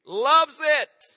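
loud, high "Loves it"; preacher's ringing "Loves it"; emphatic repeat. Real, not acted.

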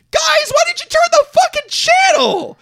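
very high, loud scolding shout; hammed-up outraged pleading; exasperated yell. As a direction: scream at the characters in disbelief. Yelling at characters in retold scene.

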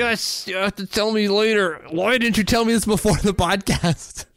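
high-pitched, worked-up protest; playful indignant squeal of words; mock outrage. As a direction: complain in an excited, squeaky-high voice. Mock protest at being kept waiting.

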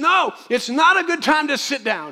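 high, loud, urgent preacher's no; preaching at full force. Acted. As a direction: refuse to let them quit.

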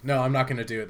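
ordinary talk

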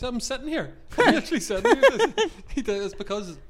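high, loud laughing protest; mock-outraged shout, cracking into laughter; exasperated laughing retort. Retelling exasperated reply to hecklers.

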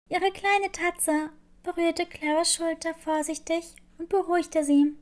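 usual speaking voice

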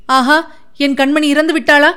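anguished cry. A narrator's reading. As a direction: cry out in disbelief, without full volume. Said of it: "என்று அலறிக்கொண்டு". (லேசான அலறல்)

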